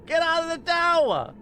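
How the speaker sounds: very high put-on cartoon yell; Carl impression. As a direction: shout it like a cartoon crank. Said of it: "the line that Obama used".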